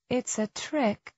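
high, soft, slow thought; wary inner voice. A narrator's reading. Tess warning herself in the pipe, high hushed inner voice.

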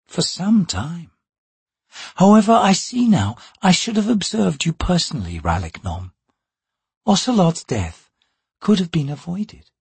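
cool, probing woman assassin; female assassin's voice. Acted. Vorkan, cool and measured.

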